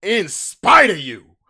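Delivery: slow, drawn-out defiant vow; defiant boast. Acted. Talking back to the ex with slow, proud defiance. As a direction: stretch it out, daring them.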